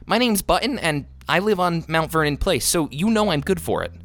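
high, indignant self-introduction; angry retort. A narrator's reading. Boy proving to the clerk he can pay, angry. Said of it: "he retorted, angrily".

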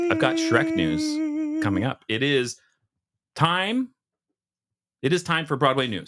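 high, slow, hammed-up announcement; announcer voice. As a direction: sing out the segment like a herald. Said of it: "announces segment jingle-style".

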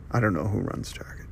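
breathy whispered aside under the breath; whispered offhand aside about Target. Real, not acted. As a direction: drop to a whisper for a throwaway aside. Aside during rant about store owners.

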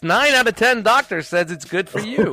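loud, high cigarette-ad pitchman; hammed-up vintage cigarette commercial; old ad announcer. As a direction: sell the Luckies like a vintage radio ad. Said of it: "she talked like this".

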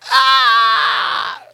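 high, loud comic scream; over-the-top panicked "ah"; mock terrified scream. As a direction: scream in hammed-up fright. Acts out inner terror.

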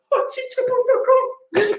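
slow, high ad-announcer voice. Acted. Announcing fake website.

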